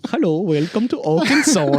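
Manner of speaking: put-on comic sing-song foreign accent; Frozen-style foreign accent. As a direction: parody a cartoon welcome with an accent. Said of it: "Is this Frozen?".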